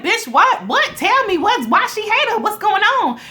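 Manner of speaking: high, loud, disbelieving demands; shocked outburst. Retold or imitated. Vlogger reliving her shock at a TV twist, high and loud. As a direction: blurt it out in total shock. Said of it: "I'm like".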